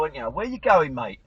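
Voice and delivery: usual speaking voice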